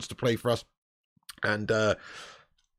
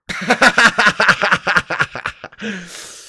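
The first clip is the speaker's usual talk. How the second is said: long, high, loud staccato laugh; mocking cackle; 4 semitones higher, 6 dB louder than usual. Real, not acted. Laughing at Klopp's thirty missed calls, mocking cackle. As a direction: cackle at a rival's misfortune.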